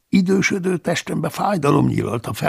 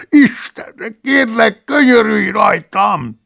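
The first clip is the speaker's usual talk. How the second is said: much higher, slow, frightened begging; pitiful whimpering plea; 10 semitones higher, 6 dB louder, slower than usual. Acted. The caught monk begging not to be killed, whimpering. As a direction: beg for your life, whining pitifully. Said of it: "nyűszítette szánalmasan".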